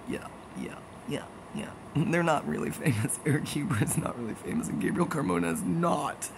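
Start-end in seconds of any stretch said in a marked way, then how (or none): 0.1-1.7 quiet, low-key imitation of indifference; unbothered mock reply. Famous friends who would never be jealous, mock indifference. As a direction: toss off soft, indifferent yeahs.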